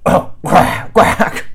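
raspy coughing quacks; coughed quack. A narrator's reading. Bird coughing up pickle juice. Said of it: "she coughed".

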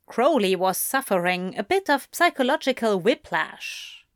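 ordinary delivery, nothing marked

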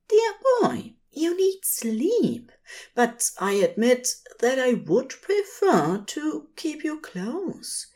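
a fussy angel coaxing his sleepy demon to rest; gentle Aziraphale voice. A narrator's reading. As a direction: tenderly urge a tired friend to sleep. Aziraphale speaks tenderly to Crowley.

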